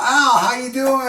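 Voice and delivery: a hint of a friendly rocker calling out; greeting Blitz.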